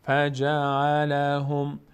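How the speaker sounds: slow drawn-out tajweed chant; Quran recitation. Shown as a demonstration. Teacher reciting the verse for the class. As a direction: recite الم یجعل slowly and evenly.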